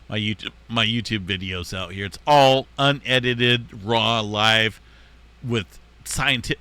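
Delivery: ordinary talk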